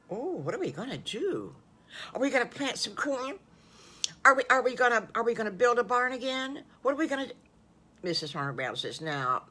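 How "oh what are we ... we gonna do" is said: put-on high, bouncing child guesses; eager little wolf. Little wolf guessing the farmers' plans, high and eager. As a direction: pepper the questions like an excited kid.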